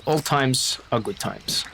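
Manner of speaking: slow, measured words of consolation; solemn, comforting Baptiste. Acted. Baptiste comforts a mourner after praying, slow and solemn. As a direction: console gently, drawing out each phrase.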